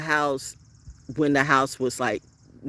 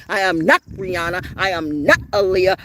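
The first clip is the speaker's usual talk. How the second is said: ordinary talk, nothing marked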